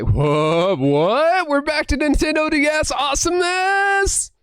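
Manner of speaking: building from stunned question to high shout; mind-blown excitement. Retold or imitated. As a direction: climb steadily into an ecstatic yell. Re-enacts mind blown by cartridges.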